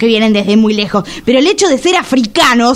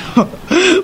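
high burst of giggling; laughing; 8 semitones higher than usual. Real, not acted. Friend giggling at the doorman's size. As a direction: giggle at how huge he is.

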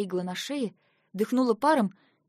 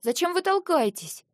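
indignant higher question; 8 semitones higher, 3 dB louder than usual. A narrator's reading. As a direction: bristle at the shove. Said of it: "возмутилась". (возмущённо)

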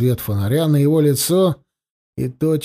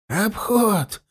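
frightened exclamation; 6 semitones higher than usual. A narrator's reading. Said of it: "испуганно воскликнул дребезжащий голос".

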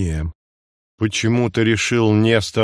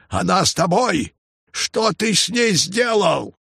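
high frantic shout; frantic shouting hostage taker; 9 semitones higher than usual. A narrator's reading. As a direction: yell it out in desperation. Shouting into the bushes.

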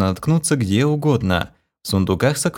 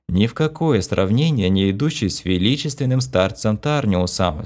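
ordinary delivery

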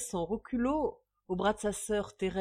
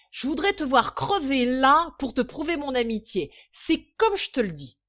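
loud, high, boisterous bragging; boastful drunkard; 8 semitones higher, 13 dB louder than usual. A narrator's reading. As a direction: swear it like an effusive drunk. Radieux pochard boasts and swears friendship. (pochard vantard, tonitruant)

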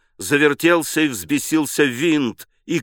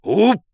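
short high cry on being struck; startled high "oop"; brief thin-voiced shout; thin yelp; 8 semitones higher, 4 dB louder than usual. A narrator's reading. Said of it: "крикнул тонкий голос".

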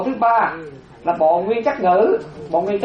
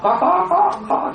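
much higher, quick insistent yes; excited retort; 12 semitones higher, slower than usual. Real, not acted. Teacher protesting to a joking student, high and insistent.

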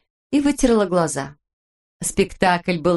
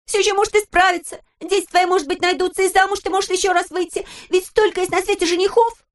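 tearful comfort; 12 semitones higher than usual. A narrator's reading. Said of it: "утешала её Пеппи сквозь слёзы".

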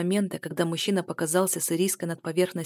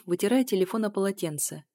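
ordinary delivery, nothing marked

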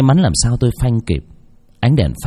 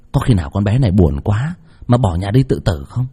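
plain reading voice

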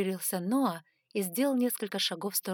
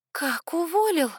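high, loud, drawn-out disbelief; shocked exclamation; 10 semitones higher, 8 dB louder, slower than usual. A narrator's reading. Heroine hearing the matchmaker is fired, shocked exclamation. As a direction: cry out in surprise, pitch soaring. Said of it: "воскликнула я".

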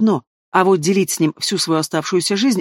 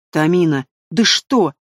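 plain reading voice

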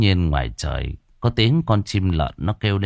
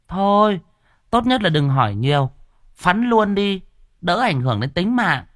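much higher woman's voice, curt and dismissive; sharp village woman; 10 semitones higher than usual. A narrator's reading. Village woman telling the stranger to clear off, high curt voice. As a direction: brush him off like a tart village gossip. Woman character answering the sorcerer.

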